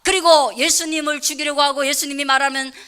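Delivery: loud, soaring preacher's climax; full-force preaching. Acted. Pastor pressing the congregation at the sermon's climax. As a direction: drive the point home at full voice.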